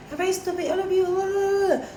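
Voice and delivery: high scolding mother voice, mock; a mom telling them off, imitated. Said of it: "she's all like".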